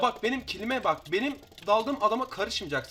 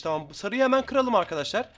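ordinary talk, nothing marked

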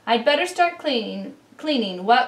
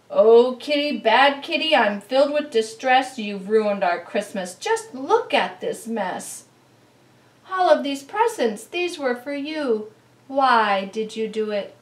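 loud, distressed scolding of the cat; dismayed owner scolding; upset scolding; 6 dB louder than usual. Acted.

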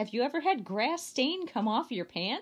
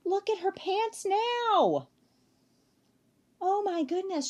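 amazed gasp; 10 semitones higher, 3 dB louder than usual. Real, not acted. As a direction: marvel at the colourful pants. Reader amazed at the picture.

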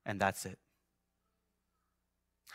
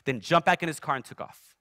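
ordinary delivery

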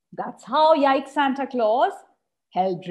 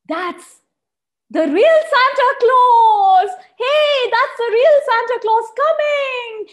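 high, loud, thrilled shout of discovery; excited cry; 12 semitones higher, 8 dB louder than usual. Acted. Baby dinos spotting Santa's sleigh, shouting excitedly. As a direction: point up at the sky, overjoyed. Babies spot the real Santa.

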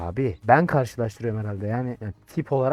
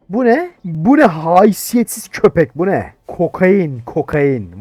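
higher, loud, slow outraged shout; furious yell; 4 semitones higher, 10 dB louder, slower than usual. Pretend. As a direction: bellow at the smuggler. Inspector finds cocaine, yells.

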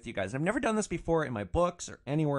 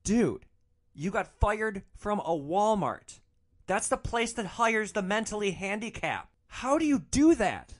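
incredulous ribbing; 7 semitones higher than usual. Retold or imitated. Passenger to friend fired from Walmart, incredulous. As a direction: question a friend, utterly incredulous.

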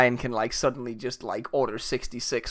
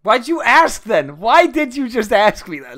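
loud exasperated question; indignant shout; 10 semitones higher, 8 dB louder than usual. Real, not acted. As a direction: demand it, outraged. Indignant at the answer.

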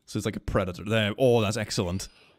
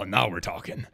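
ordinary talk, nothing marked

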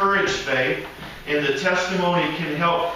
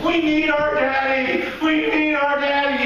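put-on higher, childish plea for daddy; whining kids; 8 semitones higher than usual. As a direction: whine like small kids missing their dad. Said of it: "my kids walked up to you and said".

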